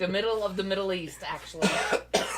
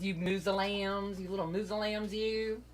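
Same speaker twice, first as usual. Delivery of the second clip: smart-ass teasing; 4 dB quieter, slower than usual. Retold or imitated. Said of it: "I'd say that when I wanna be a smart ass".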